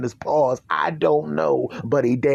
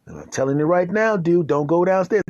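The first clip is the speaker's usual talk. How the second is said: usual speaking voice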